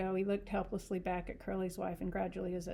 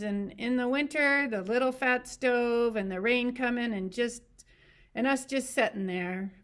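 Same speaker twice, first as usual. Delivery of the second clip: sing-song; 4 semitones higher, 8 dB louder than usual. A narrator's reading. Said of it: "went on in a sing-song voice".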